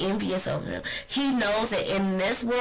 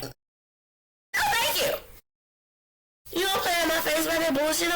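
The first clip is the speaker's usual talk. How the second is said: a higher, sharp no thank you, then faster; retold indignant retort; 9 semitones higher than usual. Retelling her reaction to the Oscar win, mock indignant. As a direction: snap it back like she's had enough. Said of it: "And I was like".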